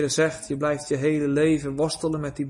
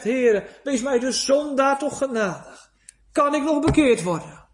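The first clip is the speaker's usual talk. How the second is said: crying out to God; 7 semitones higher, 3 dB louder than usual. Retold or imitated. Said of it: "je schreeuwt en je roept".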